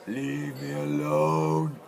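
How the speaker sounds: drawn-out fish voice. Acted. Voicing a fish for his children.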